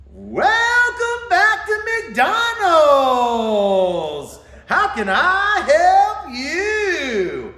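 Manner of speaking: high, loud, slow, stretched-out drive-thru greeter; hyper greeter. Retold or imitated. Imitating the greeter through the drive-thru speaker, loud and high. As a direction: belt it out like an overeager greeter. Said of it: "I could just feel the energy through the thing".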